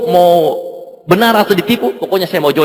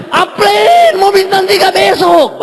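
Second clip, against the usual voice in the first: amazed disbelief; 8 semitones higher, much slower than usual. Retold or imitated. People shocked at his plan.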